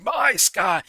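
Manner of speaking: high, loud villain shout. Retold or imitated. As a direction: declare it like a villain. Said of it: "He's like".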